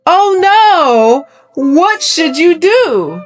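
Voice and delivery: Oh no cried high and loud; mock dismay. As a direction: play up the shock for kids.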